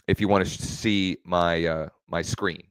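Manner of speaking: ordinary talk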